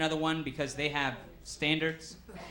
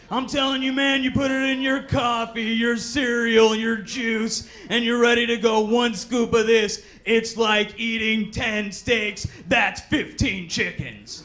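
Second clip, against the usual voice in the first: louder, slow hammed-up gym bro; pushy salesman; 3 semitones higher, 7 dB louder, slower than usual. Gym salesman overselling protein powder, slow and loud. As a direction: oversell every word slowly.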